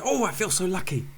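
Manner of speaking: ordinary talk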